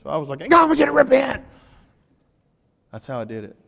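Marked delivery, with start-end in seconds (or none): 0.4-1.4 much higher, louder and faster, fiery preaching; a hammed-up fired-up preacher. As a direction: copy the interpreter's passion and blast it. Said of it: "I did get kind of fired up".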